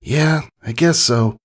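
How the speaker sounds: sheepish reply. Acted. Scootaloo conceding with a smile.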